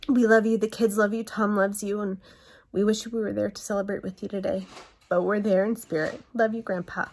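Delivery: ordinary talk, nothing marked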